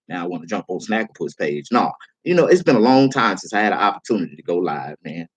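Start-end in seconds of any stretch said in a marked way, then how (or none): 0.1-1.7 mock dejected troll. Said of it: "sitting there looking like".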